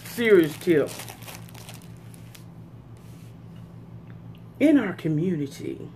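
talking between bites; eating while speaking; mouth full, chewing. Real, not acted. Seen 0: put food in mouth at -1.0, eating at plate throughout.

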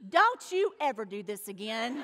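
low, hushed mock threat; stern motherly warning. Mother's warning with finger in face.

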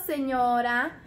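ordinary talk, nothing marked

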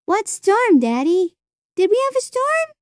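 put-on very high, small child's voice; high-pitched childlike bewilderment; innocent little girl. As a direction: ask Daddy in puzzled, squeaky innocence. Little girl innocently asks her father.

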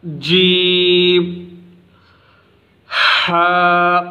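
جیم stretched very slow, ہا from the throat; slow careful letter names; drawn-out letter recitation. Shown as a demonstration. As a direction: recite جیم and ہا like a Quran teacher. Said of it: "جیسا کہ میں آپ کو پڑھ کر سناتا ہوں".

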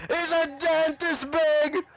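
high, loud, slow overblown claim; hammed-up high boast; mock exaggeration. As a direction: stretch it out like a fish story. Ding story exaggerated to friend.

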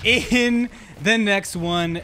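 ordinary talk

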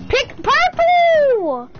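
high, loud panicked yell; urgent yelling. Real, not acted. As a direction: shout at them to hurry. Urgently calling team colour.

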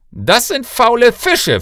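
loud, high, slow rebuke; furious father cutting off excuses; angry king. Acted. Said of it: "Trotz dieser zornigen Rede".

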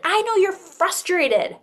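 slow, high, gentle empathy; calm the upset child slowly; teacher to frustrated child, slow and soothing. Shown as a demonstration. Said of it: "when you see a child be like".

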